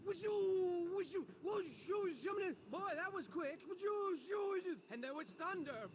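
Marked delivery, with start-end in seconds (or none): 0.0-2.1 swooping, shouted wind noises; loud mock storm whoosh. Narrator acts out the sudden storm, loud whooshing. 3.7-4.8 swooping, shouted wind noises; loud mock storm whoosh. Narrator acts out the sudden storm, loud whooshing.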